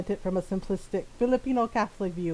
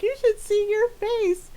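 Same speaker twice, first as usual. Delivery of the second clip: much higher gleeful gotcha, slow; mocking taunt; 13 semitones higher, 3 dB louder, slower than usual. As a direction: crow over the prank. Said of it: "popped off".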